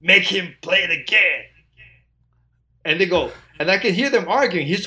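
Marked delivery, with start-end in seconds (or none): 0.0-1.4 [name] voice. Retold or imitated. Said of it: "I see him hit the talkback button".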